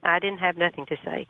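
usual speaking voice

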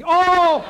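put-on a high, loud drunken whoop; drunken yelp. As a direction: let out a tipsy cry. Drunk on vodka, exclaims.

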